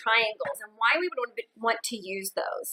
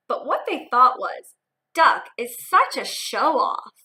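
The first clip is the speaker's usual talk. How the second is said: put-on lively read-aloud for young kids; expressive picture-book narration; storybook reading; 4 dB louder, slower than usual.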